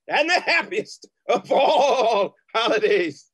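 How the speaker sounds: slow, beaming laugh through the greeting; giddy laughing joy. Acted. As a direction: burst with laughing holiday cheer. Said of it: "Are you feeling okay, Uncle?".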